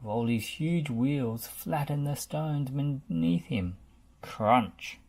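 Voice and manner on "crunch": plain reading voice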